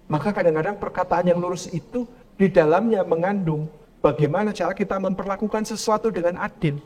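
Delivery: normal talking voice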